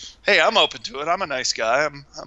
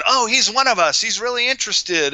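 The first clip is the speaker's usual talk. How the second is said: high, eager imitation of a taken-in believer; mock gullible enthusiasm; 9 semitones higher than usual. Investigator mimicking friends fooled by a newcomer, mock eagerness. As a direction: gush it in a higher voice, mimicking the fooled. Voicing people fooled by the man.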